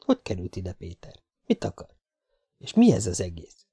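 soft puzzled questions to oneself; muted bewilderment. Acted. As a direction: wonder it under your breath. Baffled man thinking to himself. (halkan, értetlenül magában töprengve)

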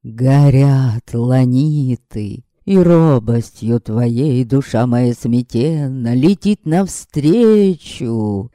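slow, pompous verse recital; lovesick declaiming. Acted. Vampire declaiming verse at Yaska, slow and pompous. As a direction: recite it slowly like a bad poet.

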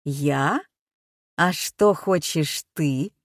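low, slow mocking machine voice; metallic robot voice. A narrator's reading. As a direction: taunt her through a voice changer. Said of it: "механический, какой-то металлический голос".

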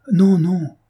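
high, loud cry of denial. Acted. Maria defending the man she loves, crying out. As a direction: cry out to cut off the accusation. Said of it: "s'écria aussi Maria".

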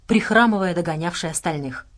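ordinary delivery, nothing marked

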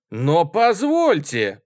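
loud, high, drawn-out objection; shouting. A narrator's reading. Student interrupting from the table's far end, shouting. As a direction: call out to be allowed in. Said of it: "с другого конца стола кричал студент".

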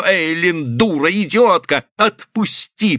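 high-pitched, urgent angry growl; hoarse roar. A narrator's reading. Husband pulling his wife off her victim, hoarse angry roar. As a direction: roar insults, drag out the order. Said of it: "прорычал он хриплым, странно изменившимся голосом".

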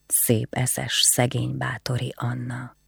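tenderly embracing Anna. Acted. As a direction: murmur it lovingly, close to her. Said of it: "szeretettel nézett az asszonyra".

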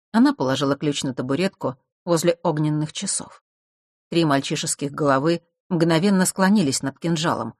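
ordinary delivery, nothing marked

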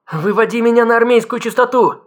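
high, frantic scream; breaking into a scream. A narrator's reading. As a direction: scream it in panic. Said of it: "Чикахо сорвалась на крик".

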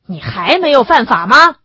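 sharp rhetorical rebuke; indignant retort. A narrator's reading. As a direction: throw the accusation back at him. Secretary retorts to defiant accused.

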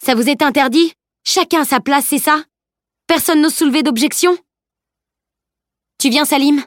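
high-pitched, rising angry questions; fired-up, provoking questions; indignant challenge. A narrator's reading. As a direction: goad them, voice lifted high. Camille angrily challenges her friends.

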